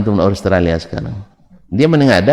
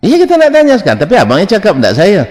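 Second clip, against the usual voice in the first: excited protest; 9 semitones higher, 5 dB louder, faster than usual. Real, not acted. Eager reply to phone suggestion.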